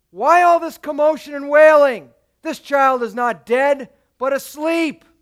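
Jesus confronting the mourners, loud and commanding; forceful rebuke. Acted.

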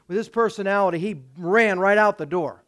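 normal talking voice

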